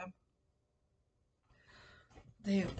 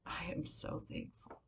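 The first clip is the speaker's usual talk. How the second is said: tearful whisper; 9 dB quieter than usual. Real, not acted. Tearful vlogger repeats her thanks.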